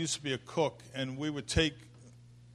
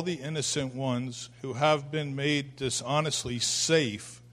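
slow, louder, weighty reading of Merton; solemn reading; 5 dB louder than usual. Acted. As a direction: read it out like a verdict. Reads Merton aloud.

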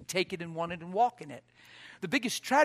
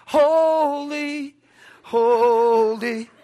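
mock churchy piety; 8 semitones higher, 12 dB louder, much slower than usual. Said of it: "then you run to church".

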